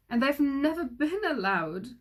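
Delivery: tearful king pleading; lightly sobbing king. A narrator's reading. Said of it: "he sobbed".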